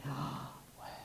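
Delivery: Yahweh breathed out softly, almost no voice. Shown as a demonstration. Preacher demonstrating the breathed name again. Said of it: "Can you hear that?".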